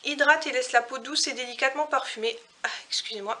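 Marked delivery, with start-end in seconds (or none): 2.6-3.4 hushed, about to sneeze; sur le point d'éternuer. Real, not acted. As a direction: whisper while holding back a sneeze. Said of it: "je vais éternuer". (au bord de l'éternuement)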